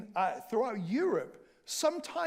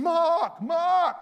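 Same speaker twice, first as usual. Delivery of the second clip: loud call, higher pitch; calling out a name; 7 semitones higher, 8 dB louder than usual. Retold or imitated. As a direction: call Mark from across the room. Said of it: "Nita sometimes is shouting".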